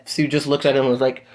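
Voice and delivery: ordinary talk, nothing marked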